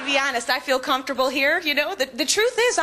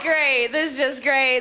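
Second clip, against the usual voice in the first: sarcastic; 3 semitones lower, slower than usual. Retold or imitated. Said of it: "I was like".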